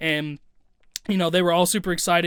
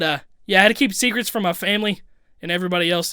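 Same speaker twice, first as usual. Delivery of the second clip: usual speaking voice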